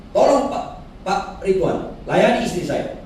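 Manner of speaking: imitating a man asking help for his wife; pleading visitor.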